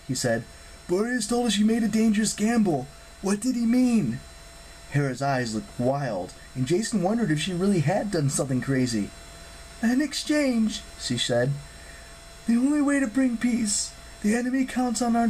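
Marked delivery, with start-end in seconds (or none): 0.9-4.2 high-pitched, pressing question to a goddess; worried hero, pitched high; questioning young hero. Acted. Voices Jason questioning the goddess. 9.8-10.8 high, slow, drawn-out pronouncement; AN EXCHANGE as a weighty statement, falling at the end; solemn goddess. Acted. Hera answers Jason's question.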